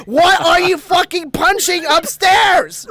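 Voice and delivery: slow, high, loud shout, every word drawn out; retold yell. From the step to mom who can't hear, retold yelling. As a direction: bellow it upstairs, imitating yourself. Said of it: "So I yell at my mom".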